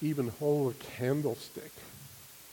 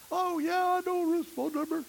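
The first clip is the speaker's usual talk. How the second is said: much higher, bright retold girl's reply; young woman's voice. Preacher voicing a church friend answering his question, high girlish voice. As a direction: pitch way up to play the cheerful friend.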